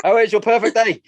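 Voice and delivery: high, loud, squeaky mimicry of the daughter; mock teen voice. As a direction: parrot her back in a teasing high voice. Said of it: "I was like".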